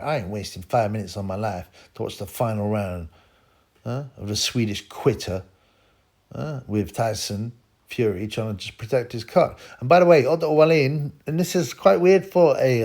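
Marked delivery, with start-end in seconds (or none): none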